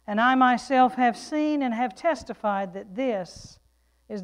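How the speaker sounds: loud, emphatic pulpit reading; declamatory Bible reading; scripture reading. Real, not acted. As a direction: proclaim the scripture out to the congregation. Reads scripture to congregation.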